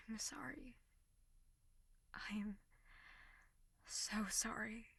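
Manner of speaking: soft remorseful whisper; sultry narrator. Acted. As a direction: whisper the apology tenderly. Whispered apology to crying listener.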